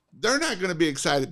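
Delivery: ordinary talk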